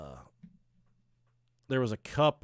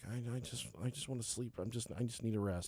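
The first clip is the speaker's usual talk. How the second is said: soft, worn-out plea for sleep; quietly begging for rest; exhausted wife; 2 semitones lower, 11 dB quieter than usual. Retold or imitated. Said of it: "my wife's like".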